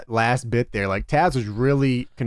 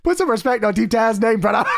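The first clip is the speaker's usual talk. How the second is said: Taz impression; 9 semitones higher, 3 dB louder than usual. Mocking Taz catchphrase.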